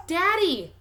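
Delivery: put-on high, loud child's call. A narrator's reading. Toddler calls out to her dad in the driveway, high and loud. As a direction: call across the yard to Daddy. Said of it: "she calls out".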